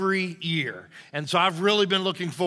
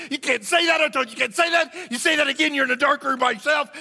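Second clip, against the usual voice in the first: scolding; 7 semitones higher, faster than usual. Retold or imitated. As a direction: bawl out the bird. Owner scolds the parrot.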